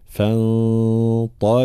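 فانطلقا with light ف and hidden ن, slow; tajwid demo. Shown as a demonstration. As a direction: slowly show ف then nasal ن in فانطلقا. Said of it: "ترقيق الفاء ثم نون مخفاة هنا". (ترقيق الفاء وإخفاء النون)

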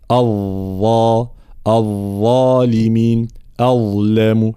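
drawn-out heavy ظ in الظالمين and أظلم; tongue-tip ظ demo. Shown as a demonstration. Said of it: "طريقة نطق الظاء التي تخرج من طرف اللسان". (نطق الظاء مفخمة من طرف اللسان في «الظالمين» و«أظلم»)

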